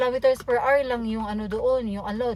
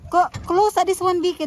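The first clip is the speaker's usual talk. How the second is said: calling out; 7 semitones higher, 8 dB louder than usual. Real, not acted. Calling out to Vicky.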